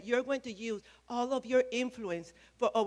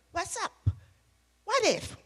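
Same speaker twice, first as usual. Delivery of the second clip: much higher, bursting with eager energy; hyper Shane; 10 semitones higher than usual. Retold or imitated. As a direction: imitate Shane bouncing with an idea. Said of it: "you know, hyper he is".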